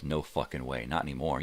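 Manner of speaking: ordinary delivery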